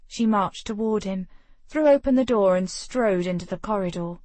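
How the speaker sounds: plain reading voice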